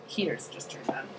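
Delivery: soft whispered aside. Real, not acted. Telling her partner about the heater in a hushed whisper. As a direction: breathe it out under the noise.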